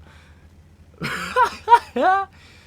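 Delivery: very high, loud laugh; helpless high-pitched laugh; excited laughter. Real, not acted. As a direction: burst into a short shrieking cackle. Erupts at the ram kill.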